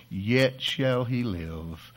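slow it right down, word by word; slow, weighty. Acted. Jesus promises Martha life, said slowly and weightily. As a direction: let each word land, slowly.

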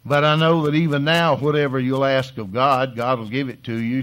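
loud, pleading cry to Jesus; pleading Martha. Acted. Grieving sister pleads with Jesus, loud and urgent. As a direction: Martha begging Jesus with all her faith. Preacher voicing Martha's plea.

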